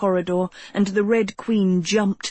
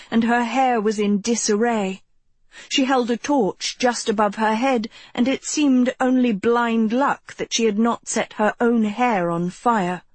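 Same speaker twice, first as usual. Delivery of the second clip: ordinary delivery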